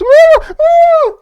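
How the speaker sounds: two very high, loud screams; acted screams. Game master as the kicked captive in the bag, high screams. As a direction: shriek like a captive kicked in a sack. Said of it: "and the screams".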